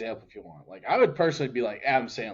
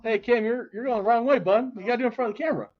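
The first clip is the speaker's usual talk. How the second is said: higher, calling out across the room; calling from far away; 9 semitones higher, faster than usual. Real, not acted. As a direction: call over to the cameraman. Redirecting cameraman, raised higher voice.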